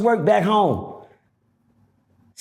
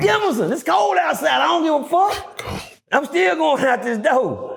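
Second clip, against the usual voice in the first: defiant shout; 9 semitones higher than usual. Retold or imitated. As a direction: yell it high like you're calling out. Retold defiant yelling at guard.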